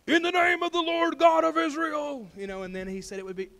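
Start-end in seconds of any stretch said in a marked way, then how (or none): 0.1-2.3 loud, high, sustained proclamation; grand mock-prophetic declaration; booming prophet. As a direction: call it out like a prophet over someone. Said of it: "stretch his hand over him and say".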